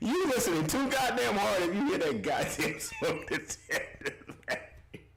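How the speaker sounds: laughing. Real, not acted. Laughing at super chat.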